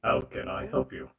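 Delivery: stiff robot bartender; a mechanical robot voice. Acted. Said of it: "Let me turn on the robot voice for this".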